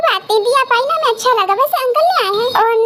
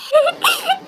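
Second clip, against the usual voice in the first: burst of laughter; 8 semitones higher than usual.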